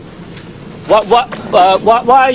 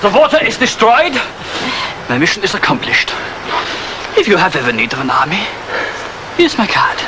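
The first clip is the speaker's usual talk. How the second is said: lightly voiced cool soldier, raised at the ends; ruthless mercenary; 5 semitones higher than usual. Kessler's parting shot to the officer, cool and mocking. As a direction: hand over the card with a smirk.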